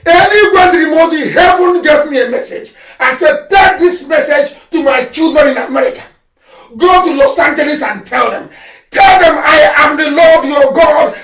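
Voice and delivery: loud, fervent preaching; fiery sermon voice. Acted. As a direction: proclaim it like a sermon. Prophetic message, shouted.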